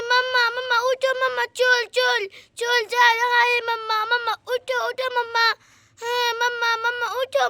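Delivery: put-on high little-kid voice, repeating short cries; alarmed small child. Small child waking mother and aunt about a thief, urgent. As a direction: urge the grown-ups awake like a toddler.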